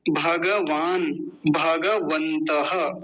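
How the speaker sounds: भगवान and भगवन्तः slowly, every syllable sounded; full-ga Sanskrit pronunciation. Shown as a demonstration. Teacher likening one word to another, slow Sanskrit pronunciation. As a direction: draw out bha-ga in भगवान, भगवन्तः. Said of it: "बिल्कुल वैसा ही इसका भी उच्चारण".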